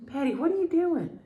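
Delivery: high sing-song voice to the pet; pet talk. Real, not acted. Talking to crying pet.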